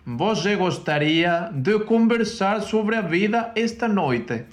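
slow Portuguese with a high, lifting question; Portuguese phrase. Shown as a demonstration. Teaching partner hears her try the Portuguese question slowly. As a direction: sound out the Portuguese sentence slowly, aiming for a question. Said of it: "la traducción que yo haría al portugués sería".

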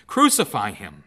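a loud, hammering crowd shout. Acted. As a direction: bellow it over the first cry. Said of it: "they kept shouting even louder".

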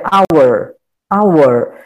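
"hour" said as "our", with a silent h; model pronunciation. Shown as a demonstration. Teacher showing students the silent h in "hour". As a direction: say "hour" with no h sound, starting on the vowel. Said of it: "a pronúncia dela é".

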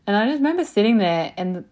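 ordinary talk

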